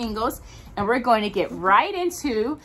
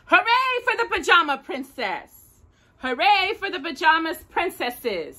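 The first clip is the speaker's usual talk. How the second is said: cheering; 8 semitones higher than usual. Acted. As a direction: cheer her on like a crowd. Josephine cheers for Daisy.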